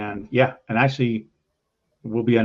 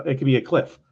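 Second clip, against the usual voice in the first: normal talking voice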